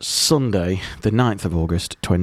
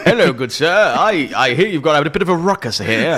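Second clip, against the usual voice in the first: higher, plummy mock-polite toff; posh disguised gentleman; 6 semitones higher than usual. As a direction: greet the shopkeeper as a pompous gent. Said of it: "put on a fake moustache".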